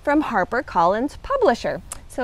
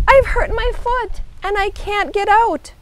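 high, mildly raised yell; 8 semitones higher than usual. A narrator's reading. Mouse calling up to Hedgehog, mildly yelling. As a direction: call up from below in a high voice. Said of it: "Mouse yelled".

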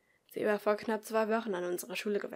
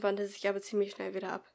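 ordinary delivery

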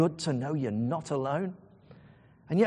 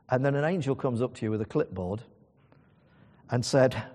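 ordinary talk

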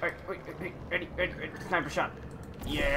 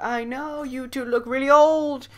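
put-on cheeky kid; 10 semitones higher, 9 dB louder than usual. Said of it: "I'm gonna be like".

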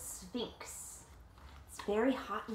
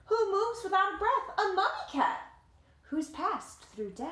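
lively read-aloud voice, higher than the chat; story narration; 9 semitones higher, 4 dB louder than usual. A narrator's reading. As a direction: tell it like a picture book to kids. Reading the picture book aloud.

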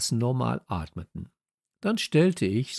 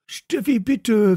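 high, louder begging plea; pleading; 12 semitones higher, 8 dB louder, slower than usual. Acted. Kalle begs Steffi to stay, high pleading cry. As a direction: beg her not to leave. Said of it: "flehte Kalle".

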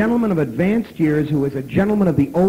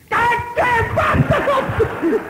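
very high, very slow shout with a laugh; furious shout; much slower than usual. Retold or imitated. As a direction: stretch out a laughing furious yell. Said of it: "And I say".

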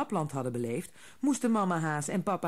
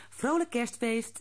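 higher, cheerful mother voice; mother's greeting; 8 semitones higher than usual. A narrator's reading. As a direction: greet them warmly as mother. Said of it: "zei ze". (moeder die vrolijk groet)

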